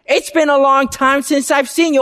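cheerful hail to a returning visitor; friendly greeting. Retold or imitated. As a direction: greet him like you're glad to see him. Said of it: "you can say".

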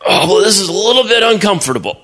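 high, fast, fretful voice; a boy squirming in heavy armor; David groaning under Saul's armor; awkward complaint. Acted.

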